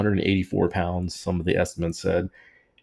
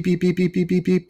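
higher beeps like a satellite signal; mimicking a radio beep; imitated beeping; 11 semitones higher than usual.

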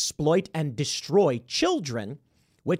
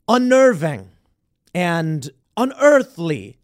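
slow, heavy, louder ominous stress on each word; ominous emphasis; 8 dB louder, slower than usual. Retold or imitated. Borrowing a pundit's phrase for Drew Barrymore, slow ominous emphasis. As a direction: lean into the spooky words like a horror trailer. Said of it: "as Matt Walsh would describe".

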